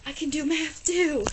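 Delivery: high, loud proud cheer; high triumphant brag after solving the puzzle. Retold or imitated. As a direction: crow about the right answer. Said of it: "and I was like".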